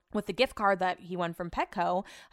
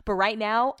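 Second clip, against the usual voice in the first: ordinary talk, nothing marked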